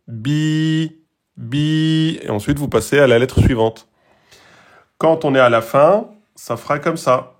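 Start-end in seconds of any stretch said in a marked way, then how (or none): 0.1-2.2 "Bi, bi" each with the i held long; long i in two beats. Shown as a demonstration. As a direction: draw out the i of "bi" twice. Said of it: "C'est la prolongation". (« bi » tenu deux temps)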